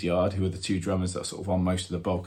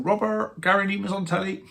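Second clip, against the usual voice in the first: high put-on mum voice calling out; imitating mum; 11 semitones higher than usual. Mum calling son from window, high put-on voice. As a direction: call from the window like an excited mum. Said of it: "She doesn't really speak like that".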